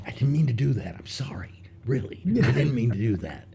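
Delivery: lightly voiced sorry bull, dropping to a whisper on the sorry; mock apologetic bull. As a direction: play the bull meekly apologizing.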